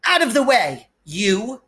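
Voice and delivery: put-on bratty boy barking at Harry; rude bully. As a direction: push past like a spoilt bully. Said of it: "he said, punching Harry".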